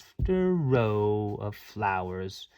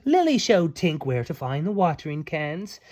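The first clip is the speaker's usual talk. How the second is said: ordinary delivery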